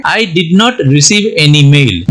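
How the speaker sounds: slow, careful English example sentence; slow example read. Shown as a demonstration. Teacher reading an example sentence slowly to students. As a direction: read it word by word. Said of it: "इसे sentence बनाते हैं".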